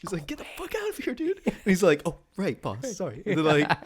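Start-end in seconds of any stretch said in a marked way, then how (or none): 0.2-1.4 much softer, higher hissed shoo; hushed shoo. Retold or imitated. Bruce shooing Robin quietly. 2.0-3.2 much softer stage whisper; hushed call. Retold or imitated. Robin whispering apology.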